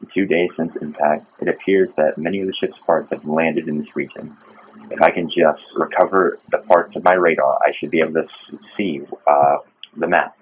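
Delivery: low, soft log narration; captain's log reading. Acted. Player reading out the game's crash log, subdued narration.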